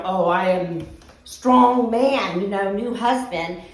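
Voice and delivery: slow, lightly voiced macho man; mock strong man. New husband coming to rescue, mock macho voice. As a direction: puff up like a hero. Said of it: "he's like".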